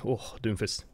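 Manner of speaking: ordinary delivery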